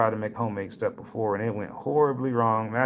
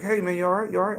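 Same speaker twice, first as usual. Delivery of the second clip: higher, concerned friend checking in; worried buddy; 8 semitones higher, faster than usual. Retold or imitated. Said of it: "my dude was like".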